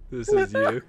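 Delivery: high-pitched giggling words; laughing high. Real, not acted. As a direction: squeak it out through laughter. Laughing at a reveal.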